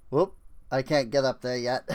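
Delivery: ordinary delivery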